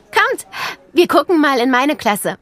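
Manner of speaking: hohe, helle Mädchenstimme, einladend; begrüß die Kleinen wie ein stolzes Schulkind; freundliches Schulmädchen. A narrator's reading. Mia führt die Kinder. (Mia begrüßt Nele am Schultor: hoch und freundlich)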